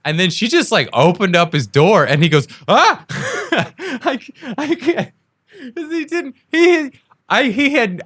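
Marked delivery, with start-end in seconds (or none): 2.7-3.0 high, loud imitated yelp; comic shriek of alarm; startled yelp. Imitating a driver whose door is yanked open, startled yelp. 3.1-8.0 high, squeaky talking through laughter; cracking up, barely getting words out; helpless laughter. Real, not acted. Storyteller cracking up at the driver's shock, helplessly laughing.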